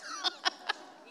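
a bright delighted laugh; amused laugh at the chocolate croissant; short laugh. Real, not acted. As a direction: laugh at the joke.

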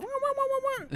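put-on muffled high wah-wah of a hooded kid; mock Kenny mumble. Joking about the Kenny-like bottle, high muffled wah sounds. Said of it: "He look like Kenny from South Park".

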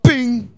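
loud bell ding; ding like a buzzer; bell sound. Pretend. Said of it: "I don't have a bell".

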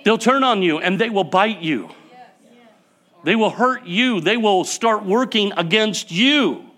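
high, loud, driving sermon voice; fiery preaching. Acted. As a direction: preach it hard to the church. Warning climax of sermon.

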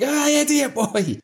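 high, drawn-out mock yell; high-pitched scolding wail; exasperated mock cry. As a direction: cry out in exaggerated exasperation, stretching the words. Acts out Hamish's friend being scolded.

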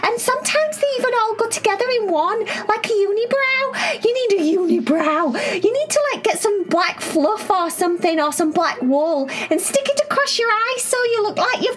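put-on bubbly, high-pitched little girl; excited high girl voice. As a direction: squeal it out, eager and excited. Cherry voiced in a story.

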